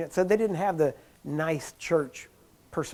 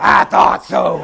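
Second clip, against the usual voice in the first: imitating Jack Coe; 12 dB louder, much slower than usual. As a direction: bellow it back as the big healer. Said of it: "he had a deep voice".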